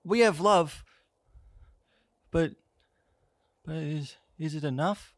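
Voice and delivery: high, halting, pleading voice; hesitant high-pitched figure; Love. Acted. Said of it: "You see Love go".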